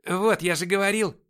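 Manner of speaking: high, loud interjection; торопливая реплика сержанта. A narrator's reading. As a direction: butt in loudly to prove your point. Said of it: "вставил сержант". (громко вставить слово)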